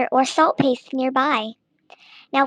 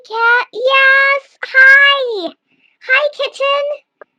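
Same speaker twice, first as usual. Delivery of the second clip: much higher, louder cat-talk, the last hello drawn out slow; high cooing pet voice; 11 semitones higher, 7 dB louder, much slower than usual. Real, not acted. Greeting his cat mid-tutorial, high cooing pet voice.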